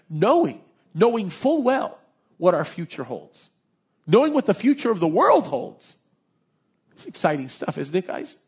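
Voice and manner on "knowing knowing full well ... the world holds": building louder and higher; rising passion. Real, not acted. Preacher rousing the congregation about the future, building louder and higher. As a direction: preach it up to a climax.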